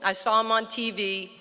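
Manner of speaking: normal talking voice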